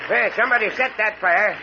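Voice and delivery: high excited calls about the oily smoke; excited outburst. Acted. Sees the fire was set.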